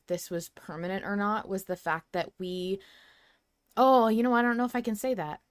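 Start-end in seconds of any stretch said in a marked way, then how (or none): none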